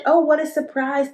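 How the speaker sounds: usual speaking voice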